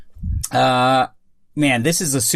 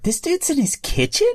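much higher-pitched disbelief; incredulous thought; 12 semitones higher than usual. Retold or imitated. Host recalls seeing the presenter's set, high-pitched incredulous question. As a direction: voice a baffled double take. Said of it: "and I thought".

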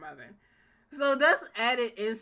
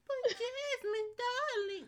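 put-on much higher, slow and pleading like a frail old lady; feeble old mother; 5 dB quieter, slower than usual. Jasmine's old mother begging her not to kick her out, in a high feeble voice. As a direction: beg like a helpless old mother. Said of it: "made her sound so feeble and old".

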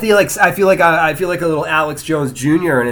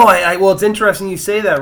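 ordinary talk

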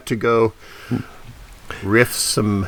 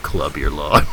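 mock tagline; 9 semitones lower than usual. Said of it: "a better tagline".

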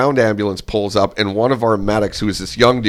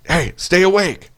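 a slower, pitched-up mock command with a sharp "Hey"; 10 semitones higher than usual. Buddy slaps him again, a slower, insistent high order. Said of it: "He's like".

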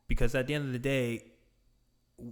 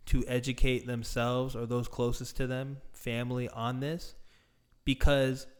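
ordinary talk

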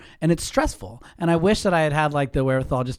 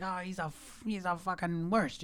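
higher, softer, lightly voiced old lady grumbling; hint of the neighbour cursing under her breath; 5 semitones higher, 11 dB quieter than usual.

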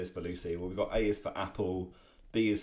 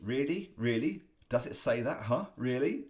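mock disbelief; 5 semitones higher, 4 dB louder than usual. Charlie Brown's puzzled quote.

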